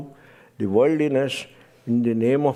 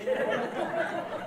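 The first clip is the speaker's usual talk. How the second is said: quick airy laugh; 10 dB quieter than usual. Real, not acted. Laughing at the story.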